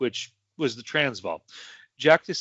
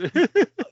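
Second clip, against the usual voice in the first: a sudden high squeal of laughter; 6 dB louder than usual. Real, not acted. Cracking up over Cockney being all swearing, loud high laugh.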